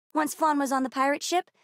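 ordinary talk

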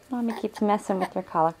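normal talking voice

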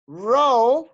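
"row" loud and drawn out; pronunciation model. Shown as a demonstration. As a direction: model "row" loudly for learners. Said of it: "Las voy a pronunciar yo".

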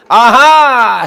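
loud hammed-up discovery; mock aha for students. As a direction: act out the sudden realization. Mock realization during explanation.

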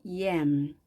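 "yam" with an initial y glide, no j sound. Shown as a demonstration. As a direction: start "yam" on a y, not a j. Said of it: "즈를 발음하지 않고". ("yam"을 '즈' 소리 없이 '옘'처럼)

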